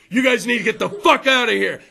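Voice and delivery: gruff security guard barking orders. Retold or imitated. Comic to crowd, voicing security guard, gruff. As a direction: act out the bouncer kicking you out. Security guys yelling at customers.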